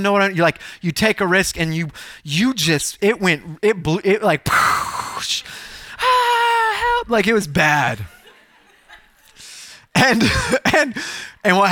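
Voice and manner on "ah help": blown-out crash noise, then high help; mock crash and cry. Preacher shows how badly the risk went, mock crash. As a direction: imitate crashing down with a squeaked plea.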